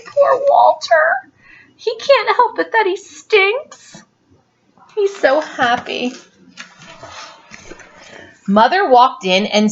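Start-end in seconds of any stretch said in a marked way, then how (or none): 0.1-6.1 put-on high, slow sympathetic kid; pitying child voice. Parent voicing a child defending the smelly dog. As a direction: coo over poor Walter.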